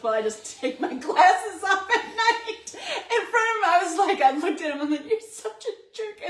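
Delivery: high, loud voice breaking into laughter; squealing with laughter as you retell it; laughing retelling. Retold or imitated. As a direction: act out the jab through helpless laughter. Retelling husband's jab amid laughter.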